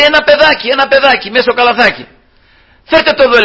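high, bright-eyed call of a discovering princess; excited princess. Retold or imitated. Pharaoh's daughter calling her maids about the baby, high and excited. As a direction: cry out in surprise at the find. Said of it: "Λέει".

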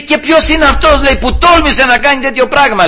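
high-pitched, indignant royal demand; acted outrage, pitched well up; outraged king. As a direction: demand the culprit like an affronted king. King demands who dared threaten the queen.

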